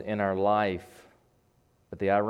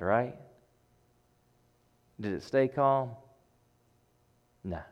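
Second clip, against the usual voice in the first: ordinary talk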